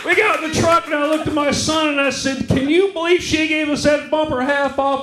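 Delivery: comic yelling about the half-off bumper; excited shout. Real, not acted. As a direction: belt it out, still thrilled.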